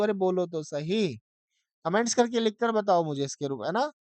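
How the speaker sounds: normal talking voice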